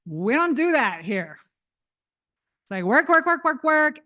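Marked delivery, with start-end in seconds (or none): 2.8-4.0 work, work, work. Pretend. Mocking nonstop American work pace.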